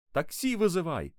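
high, urgent shout. A narrator's reading. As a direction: call out an urgent order. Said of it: "закричал папа".

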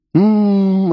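high sweet hum; blissful hum. A narrator's reading. Bartender humming sweetly at the singer on screen. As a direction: hum with sugary delight. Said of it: "сладостно улыбнулся".

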